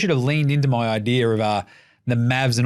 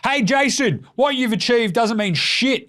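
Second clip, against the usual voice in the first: higher, smug taunting voice; mock Draymond jab; 8 semitones higher than usual. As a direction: needle a rival like a loudmouth.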